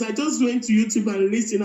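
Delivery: ordinary talk, nothing marked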